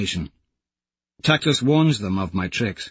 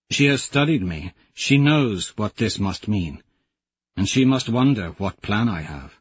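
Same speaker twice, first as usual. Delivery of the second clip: normal talking voice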